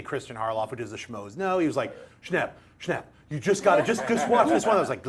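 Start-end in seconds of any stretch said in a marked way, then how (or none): 2.2-4.8 insistent, growing louder and higher; mock nagging. Imitating a colleague pushing a show on him, nagging. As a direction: pester him with a laugh underneath.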